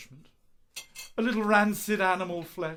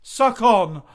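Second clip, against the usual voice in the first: louder recital; 8 dB louder than usual. Acted. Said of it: "lines I've been working on".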